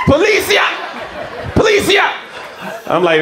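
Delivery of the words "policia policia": high-pitched yell for police; imitated high shout; mock police call. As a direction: holler it out high, raising the alarm. He's getting louder.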